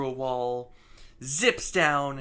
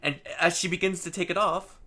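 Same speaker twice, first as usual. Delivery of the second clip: ordinary talk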